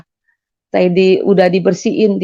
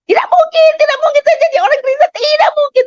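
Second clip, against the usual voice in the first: high-pitched, fast, insistent shouting; reenacted scream; faster than usual. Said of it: "Saya teriak".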